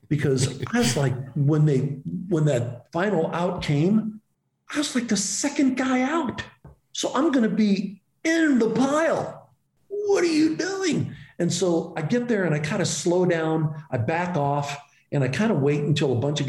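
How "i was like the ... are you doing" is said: excited retelling climbing very high; animated disbelief. Retold or imitated. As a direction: replay it with rising, squeaky exasperation. Animated replay of rushing into pile.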